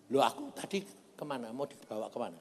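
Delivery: usual speaking voice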